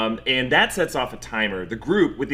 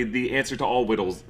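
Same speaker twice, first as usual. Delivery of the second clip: usual speaking voice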